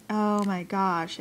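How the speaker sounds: ordinary talk, nothing marked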